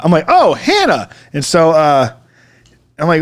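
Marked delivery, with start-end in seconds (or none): 0.3-1.1 "Hannah" pitched high with clear H; echoed correction. Retold or imitated. Man echoing the lady's corrected name, high "Hannah". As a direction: repeat "Hannah" high, sounding the H.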